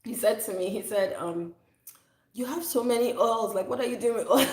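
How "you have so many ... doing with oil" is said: higher-pitched mock-baffled dad; put-on incredulous father voice; imitating dad. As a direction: play dad questioning all the oils. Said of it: "my dad came one time and said".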